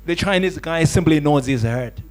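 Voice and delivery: ordinary talk, nothing marked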